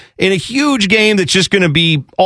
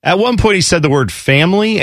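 ordinary talk

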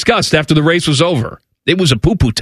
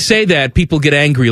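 usual speaking voice

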